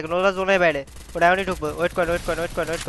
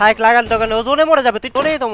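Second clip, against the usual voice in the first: urgent shout; 3 semitones higher, 8 dB louder than usual. Real, not acted. Urgent instruction in fight.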